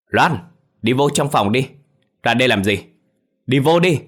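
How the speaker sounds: yelling. A narrator's reading. Father shouting at daughter to go back inside. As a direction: yell her back into the room. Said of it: "ông thét lớn".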